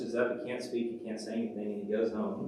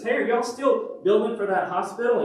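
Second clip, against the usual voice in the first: high, loud, chatty caller; caller on the phone; 11 semitones higher, 7 dB louder than usual. Acted. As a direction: ring up a friend, loud and high.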